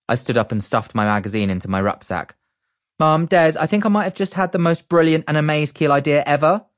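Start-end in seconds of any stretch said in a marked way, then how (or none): 3.0-6.7 high, loud and thrilled; excited. A narrator's reading. Barry tells mum and Des his idea, excited. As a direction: burst out with the big idea. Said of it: "doing an excitement blow-off".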